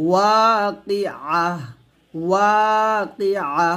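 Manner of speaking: slow ওয়াকিআ with a clear ওয়া; drawn-out ওয়াকিআ; correct pronunciation demo. Shown as a demonstration. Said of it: "ও হবে না". (ওয়াকিআ স্পষ্ট করে)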